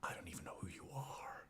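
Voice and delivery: whispered line quoting Breaking Bad; hushed movie-line whisper; whispered TV quote. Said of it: "That's Hank off Breaking Bad".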